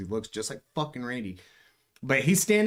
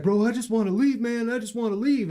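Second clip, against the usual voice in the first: high, loud mock whine; scared intruder begging to be let go, high mock plea; pleading tweaker; 11 semitones higher, 6 dB louder than usual. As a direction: beg to leave like a scared drifter. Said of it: "He's like".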